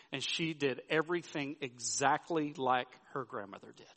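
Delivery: ordinary talk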